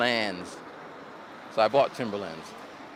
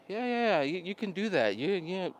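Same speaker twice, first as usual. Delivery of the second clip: high, softer, empty agreement; insincere encouragement; 6 semitones higher, 4 dB quieter than usual. Retold or imitated. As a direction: imitate someone politely humouring a plan. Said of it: "they don't really mean it".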